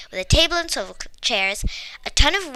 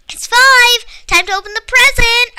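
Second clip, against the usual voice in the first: higher, louder excited announcement; boy announcing it's time; exclaimed; 12 semitones higher, 9 dB louder than usual. A narrator's reading. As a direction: burst out with excitement. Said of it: "Arjun exclaimed".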